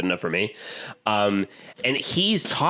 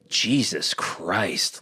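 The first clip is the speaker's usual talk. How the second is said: slow stunned "Jesus Christ"; awed Jesus Christ; much slower than usual. Retold or imitated. Fan reliving the moment, slow awed gasp. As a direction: let it drop out slowly, awestruck. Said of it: "if I was in that moment, that's what I would say".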